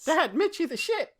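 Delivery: put-on high whining kid tattling to dad; whiny child voice. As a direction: squeal it like an upset child.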